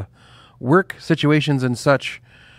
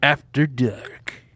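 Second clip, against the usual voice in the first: sexy voice; 3 semitones higher, much slower than usual. Shown as a demonstration. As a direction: purr it out like a late-night host. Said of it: "You say it like that".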